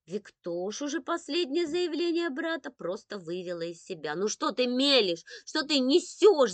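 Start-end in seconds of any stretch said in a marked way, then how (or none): 4.1-6.5 loud, raised exasperated outburst; indignant shout. A narrator's reading. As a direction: shout down a babbling brother. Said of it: "закричала она".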